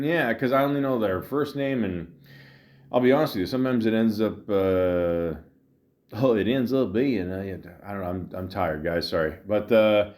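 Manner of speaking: ordinary talk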